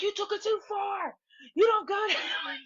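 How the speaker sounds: high, whiny imitation of her outrage; mock outraged protest. Woman scolding Slim for blocking her, mock outraged wail. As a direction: wail the complaint as she did, half laughing.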